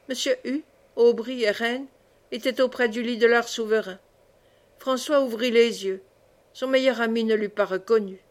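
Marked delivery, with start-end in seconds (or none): none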